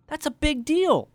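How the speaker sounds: big deal said high and loud; excited emphasis; emphatic. Shown as a demonstration. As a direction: punch it like real news. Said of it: "You've gotta match".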